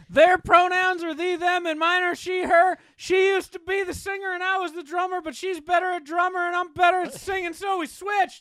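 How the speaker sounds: high-pitched mock band intro voice; mimicking band introduction. Cohost parodies the Zombie Goats clip in a high voice.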